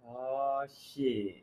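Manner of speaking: low, very soft dread; inner thought. Retold or imitated. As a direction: say it under your breath, sinking. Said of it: "I thought in my head".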